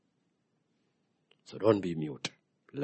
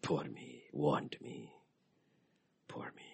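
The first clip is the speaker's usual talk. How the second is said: 1 mock pity party; 4 semitones lower, 8 dB quieter, slower than usual. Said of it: "throwing pity parties".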